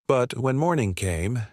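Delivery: ordinary delivery, nothing marked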